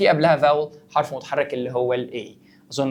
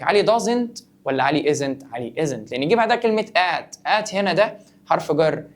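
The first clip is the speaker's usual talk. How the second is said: usual speaking voice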